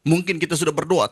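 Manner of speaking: normal talking voice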